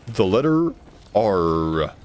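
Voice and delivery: "The letter R" said very slowly with a heavy R; slow over-pronounced R. Shown as a demonstration. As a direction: stretch out "R" slowly, exaggerating it. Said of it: "so annoying in English?".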